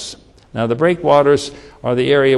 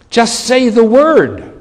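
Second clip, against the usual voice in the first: high, loud and slow, begging Jesus; pleading centurion; 9 semitones higher, 6 dB louder, slower than usual. Acted. Centurion begging Jesus to heal his servant, loud plea. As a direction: cry it out as the desperate centurion.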